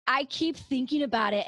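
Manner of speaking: ordinary delivery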